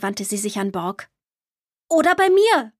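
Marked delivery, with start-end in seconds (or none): 1.9-2.7 hohe, eifrige Kinderstimme; Matti, eifrig. A narrator's reading. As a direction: mit Betonung auf „mir“ vorschlagen. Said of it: "schlug Matti vor". (kleiner Junge will Borg bei sich haben, eifrig und hoch)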